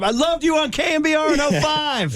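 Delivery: high-pitched pretend yell; mock fan shout. Telling cohost what to yell at strippers, high mock shout. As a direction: call it out like a fan, voice way up.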